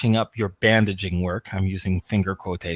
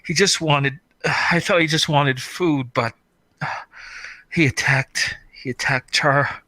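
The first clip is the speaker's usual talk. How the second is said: moaning; 4 semitones higher than usual. Acted. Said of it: "she's able to moan out".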